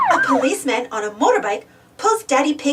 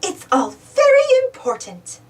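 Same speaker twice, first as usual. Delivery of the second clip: higher, prim Mummy Pig; a hint of a fussy mother; 11 semitones higher, 3 dB louder than usual. A narrator's reading. Mummy Pig insists on all her bags, lightly voiced higher. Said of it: "replies Mummy Pig".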